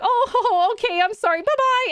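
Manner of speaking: high, imitated bashful goodbye; shy stranger. Shy man turned down by tourists, imitated backing off high and bashful. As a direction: mimic a timid guy bowing out. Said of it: "overly shy".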